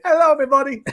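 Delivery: high, loud mock Greek accent. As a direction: echo the greeting in a hammed-up Greek accent. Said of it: "[name] smashed it".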